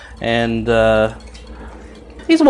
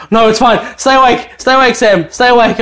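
raised, higher urgent calls; urgent pleading, retold; 8 semitones higher, 4 dB louder than usual. Dad retelling the drive to hospital, urgent calls to son. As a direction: urge him awake, re-enacting worry. Urging sleepy son in car.

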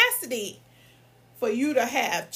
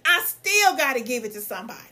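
ordinary talk

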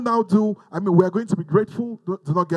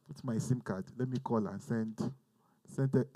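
parent voice; 9 semitones lower, 15 dB quieter than usual. Retold or imitated. Parent voiced, much softer.

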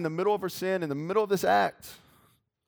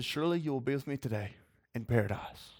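tender promise; 5 semitones lower, 7 dB quieter than usual. Acted. Jesus answers thief on cross.

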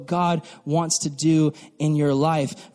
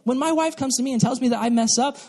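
ordinary talk, nothing marked

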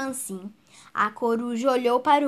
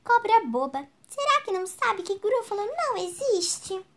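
mocking little mouse; 9 semitones higher than usual. Mouse mocks the snake.

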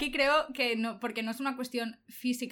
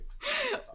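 high laugh; 4 dB quieter than usual. Real, not acted. Laughs at her own remark.

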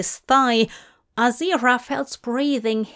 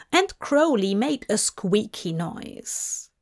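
ordinary delivery

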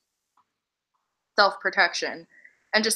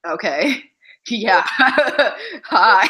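giggling shy greeting; flustered laughing hello; 5 dB louder, much slower than usual. Pretend. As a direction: laugh through a nervous hi. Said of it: "I'd be like".

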